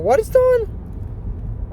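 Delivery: high drawn-out disbelief; incredulous squeak. Real, not acted. Reacting to the hexathon invite with a high drawn-out squeak.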